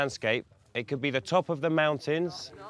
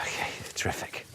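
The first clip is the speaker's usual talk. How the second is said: breathy whispered aside; whispering; 7 dB quieter than usual. Real, not acted. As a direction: say it under your breath. Dog muddy, whispered aside.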